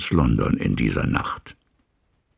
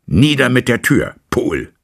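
higher and louder, urgent command; shouted order; 7 semitones higher, 6 dB louder than usual. A narrator's reading. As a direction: order the door smashed in now. Lawyer orders servant to break door. (laut gerufener Befehl)

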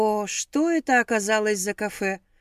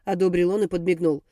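usual speaking voice

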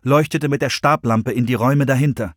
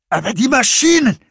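much higher, voice almost breaking; voice cracking; 12 semitones higher, 4 dB louder than usual. A narrator's reading. Nick protesting about the machines, voice cracking. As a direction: squeak it out in panic. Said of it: "Nicks Stimme überschlug sich fast".

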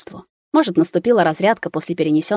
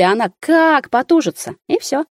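rising higher, slowing for effect; lively telling; 8 semitones higher than usual. A narrator's reading. Nurse telling anxious father about birth, excited. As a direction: build it up to the punchline.

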